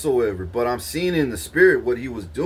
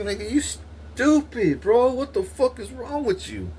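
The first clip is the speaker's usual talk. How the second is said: high incredulous put-down; 12 semitones higher than usual. Retold or imitated. Scolding Marcus over his robbery plan, high scoffing disbelief. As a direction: scoff at how dumb he is. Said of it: "I was like".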